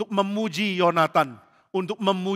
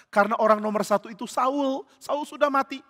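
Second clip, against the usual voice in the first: ordinary talk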